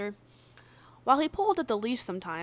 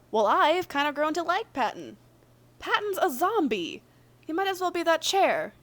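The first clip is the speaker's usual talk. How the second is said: higher and louder, exasperated; exasperated character; 7 semitones higher, 6 dB louder than usual. Acted. Logan's partner arguing about Patton, higher and louder exasperation. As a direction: push back hard, half disbelieving.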